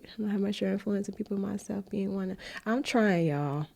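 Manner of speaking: an ugly crier laughing at herself; crying. Real, not acted. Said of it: "I'm in here crying".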